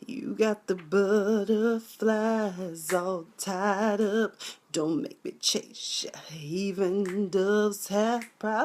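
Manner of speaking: slow, soft, breathy sultry croon; hammed-up slow sultry voice; mock seductive singer. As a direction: purr it out like a torch singer. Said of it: "And I was like".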